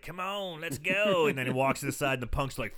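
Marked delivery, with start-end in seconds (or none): 0.0-1.3 higher, lightly voiced come-hither call; mock beckoning from a distance; luring call. Film fan voicing the woman luring punks away, beckoning.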